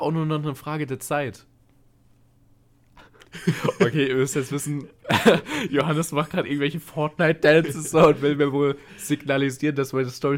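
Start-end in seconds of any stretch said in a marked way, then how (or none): 4.9-5.7 high, loud laugh; burst of laughter. Real, not acted. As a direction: laugh out at the sight of it. Laughing at friend's dance.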